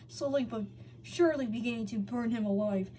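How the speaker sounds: ordinary delivery, nothing marked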